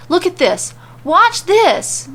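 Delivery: high, loud, gleeful; excited. A narrator's reading. Corbin showing Mandy the film, spitefully gleeful. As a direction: gloat as you point.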